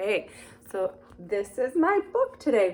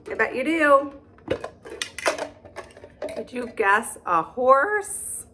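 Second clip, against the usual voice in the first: usual speaking voice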